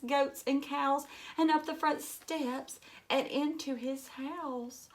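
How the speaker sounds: put-on lively read-aloud for children; storybook reading. A narrator's reading. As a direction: read it like a picture book to a class. Reads picture book aloud to children.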